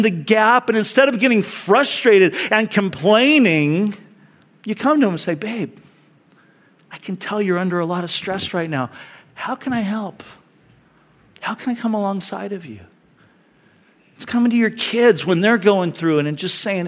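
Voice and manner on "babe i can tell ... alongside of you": soft, caring question; wife softly offering her stressed husband help; gentle offer. Acted. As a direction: reassure him quietly.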